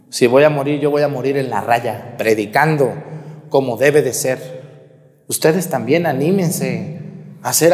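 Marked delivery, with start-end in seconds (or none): none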